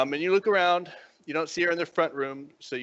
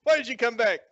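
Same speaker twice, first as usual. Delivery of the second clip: high-pitched, sharp demand; exasperated girlfriend; 9 semitones higher, 3 dB louder than usual. Acted. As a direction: challenge an unwelcome visitor, voice pitched up. Acted girlfriend challenges returning boyfriend.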